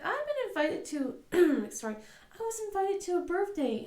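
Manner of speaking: put-on high, far-off little girl voice; eager girl. Jana piping up to mom, an eager high voice. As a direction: pipe up like a proud little girl. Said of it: "Jana pipes up with".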